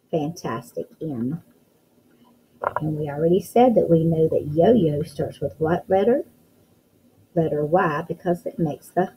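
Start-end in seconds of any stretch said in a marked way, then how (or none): none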